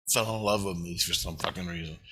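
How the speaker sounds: ordinary talk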